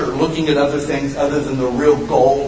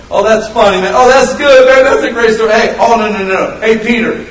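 loud, high acted banter; boisterous, pitched-up group reaction; rowdy storytelling friends; 7 semitones higher, 8 dB louder than usual. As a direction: egg a friend on over the crowd. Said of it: "everybody be like".

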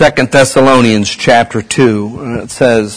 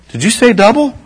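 mock excited; 9 semitones higher than usual. Friend hears salary doubled.

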